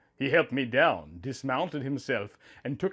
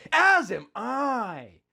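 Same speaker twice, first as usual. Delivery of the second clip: higher, slow reply as Link; a slow, tender answer as Link; Link answering warmly; 8 semitones higher, much slower than usual. Acted. Link answers his wife, slow and tender. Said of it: "Link's smile widened".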